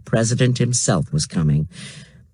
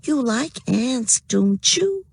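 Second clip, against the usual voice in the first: put-on high, slow, cooing baby talk; squeaky baby voice; 11 semitones higher, slower than usual. A narrator's reading. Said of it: "in her best squeaky baby voice".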